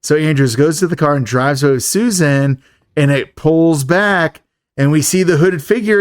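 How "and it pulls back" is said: normal talking voice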